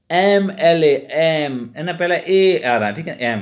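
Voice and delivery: teacher says "एमएलए" slowly, leaning on the "ए" sound; sounding out a letter. Shown as a demonstration. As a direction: stretch "एम" slowly to show it starts with "ए". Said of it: "इसकी ध्वनि जो है एम आ रही है". ("एम" को धीरे बोलकर "ए" की ध्वनि दिखाना)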